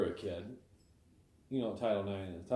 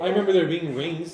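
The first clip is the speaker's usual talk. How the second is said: louder, laughing through the words; 7 semitones higher, 10 dB louder than usual. Real, not acted. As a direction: still cracking up. Family laughing at gymnastics news.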